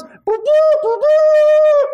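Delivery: very high, loud, sustained boop toots; trumpet toot imitation. Preacher imitating the trumpet call, high loud toots. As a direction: sing out like a bugle sounding the charge.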